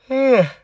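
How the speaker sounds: a big yawn. Real, not acted. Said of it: "Ai, desculpa o bocejo aí, cara, tô com sono".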